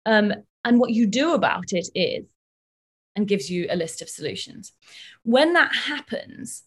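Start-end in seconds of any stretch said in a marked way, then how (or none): none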